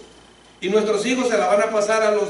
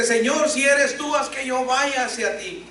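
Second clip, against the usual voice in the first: Peter's plea, higher and slow; eager Peter; 7 semitones higher than usual. Retold or imitated. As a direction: call out boldly like eager Peter. Peter voiced calling to Jesus. (Pedro le ruega al Señor, voz más aguda y lenta)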